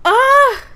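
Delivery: high, loud anguished "ah"; a loud high wail of dismay; loud outcry. Real, not acted. Player reacting to a hurt character, a loud cry.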